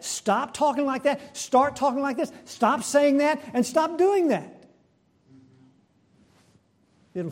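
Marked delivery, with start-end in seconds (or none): 0.0-4.6 mock scolding, pitched high; accusing. Preacher mimics moralizing at people, accusing. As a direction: rattle off bossy orders in a raised pitch. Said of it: "We accuse them. We blame them".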